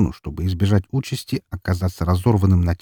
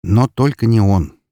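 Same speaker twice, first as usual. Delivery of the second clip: plain reading voice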